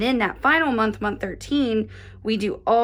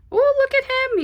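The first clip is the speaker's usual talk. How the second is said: much higher, thrilled burst; delighted squeal; 4 dB louder than usual. Real, not acted. Crafter to viewers, delighted high squeal. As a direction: gush over the cute kitten face. Delighted at the finished kitten face.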